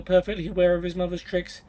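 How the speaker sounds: usual speaking voice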